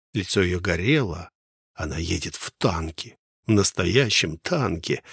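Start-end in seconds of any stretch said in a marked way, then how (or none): none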